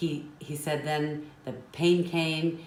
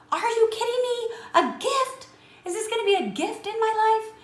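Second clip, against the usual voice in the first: much higher and louder, squeaky outraged disbelief; incredulous outburst; 8 dB louder than usual. Retold or imitated. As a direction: squeal it out like you can't believe what you just heard. Son's outraged inner reaction.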